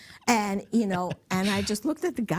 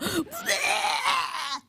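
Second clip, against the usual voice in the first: mock gasp; 8 semitones higher than usual. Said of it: "and he's going".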